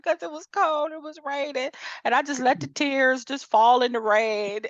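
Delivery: high, mock-tragic friend; imitating dramatic friend. Friend explaining why she cried, mock-dramatic and high. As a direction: play her dramatic sadness, hammed up. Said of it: "She was like".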